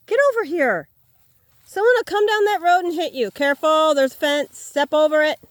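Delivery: loud, high urgent call to the dog; shouted warning. Real, not acted. Calling dog away from road, fence.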